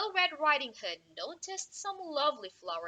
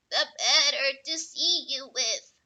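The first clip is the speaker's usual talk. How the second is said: wolf voice; 8 dB louder than usual. Acted. Wolf answers girl.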